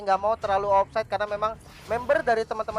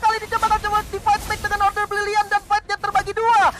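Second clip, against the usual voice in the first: higher, louder rapid-fire commentary; excited play-by-play; 9 semitones higher, 5 dB louder than usual. Real, not acted. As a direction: call the fight with rising hype. Caster calling the Lord fight.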